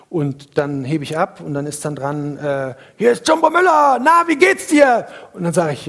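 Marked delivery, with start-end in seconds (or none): 3.0-5.1 very high, loud hearty voice; booming caller. Retold or imitated. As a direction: greet him like a loud old buddy. Jumbo Müller calls on the phone.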